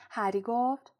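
ordinary delivery

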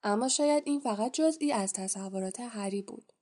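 usual speaking voice

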